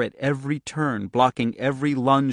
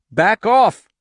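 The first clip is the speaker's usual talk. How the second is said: desperate shout; 11 semitones higher, 10 dB louder than usual. Acted. Girl fights her fire power.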